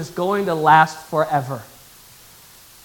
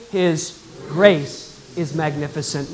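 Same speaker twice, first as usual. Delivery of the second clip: slow; 4 semitones lower, 5 dB quieter, slower than usual. Shown as a demonstration. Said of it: "Could you say that with me?".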